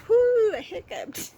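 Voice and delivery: high, loud, slow cheer; real delighted whoo; excited. Real, not acted. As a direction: celebrate the tiny win. Mock excitement about hiccup.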